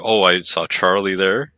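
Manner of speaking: ordinary delivery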